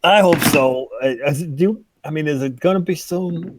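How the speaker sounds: worried, halting and high; anxious hostage. Acted.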